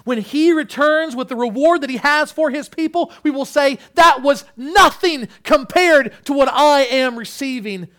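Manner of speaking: high, loud, emphatic proclamation; full-force preaching. Real, not acted. Preacher assuring church of the king's reward, full-force preaching.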